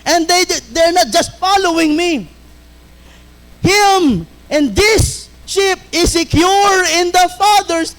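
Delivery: reading scripture with full preaching force; fervent preaching. Acted. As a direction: preach it out to the congregation. Preacher quoting scripture to doubters.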